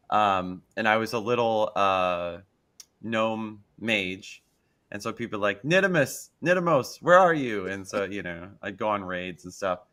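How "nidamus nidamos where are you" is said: higher, louder mock call. Gamers call for their missing gnome mage, calling out. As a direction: imitate players hailing a teammate.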